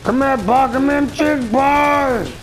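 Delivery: high, loud drawn-out taunt at the enemy; taunting call. Real, not acted. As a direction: yell it out to bait the opponent.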